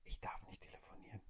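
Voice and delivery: soft secret whisper; whispering on the phone. Retold or imitated. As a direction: whisper so nobody hears. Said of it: "warum flüsterst du so?". (leises Flüstern am Telefon)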